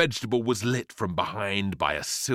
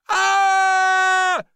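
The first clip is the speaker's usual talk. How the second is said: long, very high, loud shriek; terrified scream; 10 dB louder than usual. A narrator's reading. Boy attacked by flying rhubarb, screaming. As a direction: scream at full tilt. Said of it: "screamed the boy".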